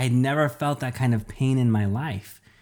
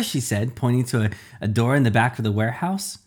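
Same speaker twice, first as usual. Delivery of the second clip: ordinary delivery, nothing marked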